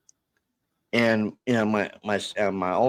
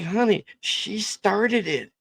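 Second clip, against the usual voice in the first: high, drawn-out mock protest; stretched, high-pitched self-defense; retold defensive protest; 12 semitones higher, slower than usual. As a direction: plead your case to a wife, pitched up and slow. Retells defending himself to his wife.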